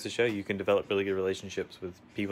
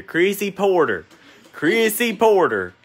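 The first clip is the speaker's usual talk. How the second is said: loud, high, drawn-out yell of a name; mock yell of her full name, slow and loud; 8 semitones higher, 10 dB louder, much slower than usual. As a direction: call her name out across the room. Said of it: "I keep hearing Stan yell my name".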